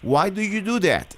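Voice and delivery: usual speaking voice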